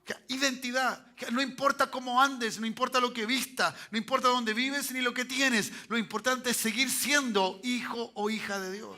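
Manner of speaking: high, forceful sermon delivery; impassioned preaching. Real, not acted. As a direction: preach it out to the congregation.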